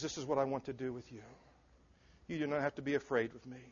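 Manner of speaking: soft, quick, gentle reassurance as Jesus; tender reassurance. Acted. Preacher voicing Jesus to the frightened, softly reassuring. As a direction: coax them in gently, nothing to fear.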